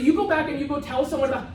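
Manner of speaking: ordinary delivery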